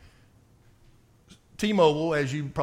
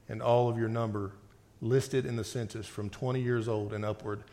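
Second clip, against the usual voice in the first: lower, softer measured Bible reading; scripture reading; 6 semitones lower, 5 dB quieter than usual. Acted. Preacher reading God's judgment to the congregation, grave reading voice.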